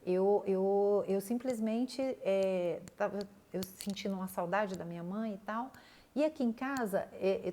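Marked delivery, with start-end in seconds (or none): none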